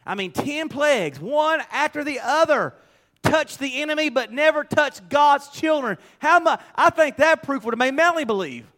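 high-pitched, emphatic pulpit delivery; forceful preaching. Real, not acted. Preacher marvelling at the ten plagues, forceful preaching. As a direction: drive each phrase home to the congregation.

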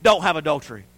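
forceful, falling commandment; firm command. Retold or imitated. The law telling us what not to do, firm command. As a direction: lay it down as law. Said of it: "The law told us".

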